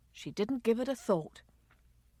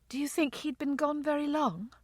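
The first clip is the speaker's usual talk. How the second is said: higher, subdued voice; small, pitched-up, sorrowful; grieving question; 7 semitones higher, 4 dB quieter than usual. Acted. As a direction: ask timidly through grief. Grieving daughter asks about death.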